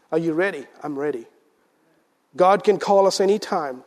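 ordinary talk

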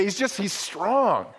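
ordinary talk

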